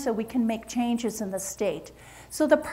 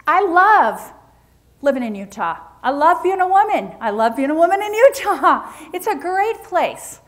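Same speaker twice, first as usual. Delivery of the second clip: enthusiastic; 4 semitones higher, 6 dB louder than usual. Real, not acted. Enthusiastic declaration of love.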